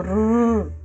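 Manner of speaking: long trilled rrr like a car engine; adult teaching a child to trill, rolled rrr. Shown as a demonstration. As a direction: roll the r like a toy car. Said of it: "enseñarle a pronunciar la vibración".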